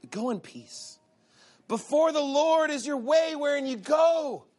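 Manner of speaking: high, slow, sugary priestly blessing; pious blessing. Retold or imitated. Imitating the priest blessing the men, syrupy and slow. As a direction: bless them sweetly and slowly.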